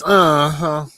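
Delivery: put-on old granny "Uh-huh"; grandma drawl on "Uh-huh"; nana voice. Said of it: "In my nana voice".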